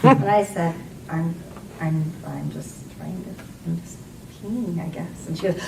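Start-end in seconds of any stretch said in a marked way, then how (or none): 1.1-5.3 soft, halting confession; awkward character reply. Retold or imitated. Woman answering the next bathroom stall, awkward and hushed. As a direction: stumble quietly through an embarrassed answer. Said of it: "that's how my vagina would sound".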